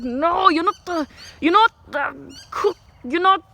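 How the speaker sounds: slow mock scolding in an Indian accent; bad Indian accent. Boss scolds the fancy cheese layer, in a mock Indian accent. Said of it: "horrible Indian accent".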